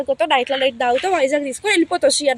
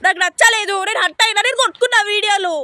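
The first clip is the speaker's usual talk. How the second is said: raised, much higher voice in a heated fight; angry shouting; 6 semitones higher than usual. Acted. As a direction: yell it out, fed up and furious. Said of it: "మెల్లగా మాట్లాడు".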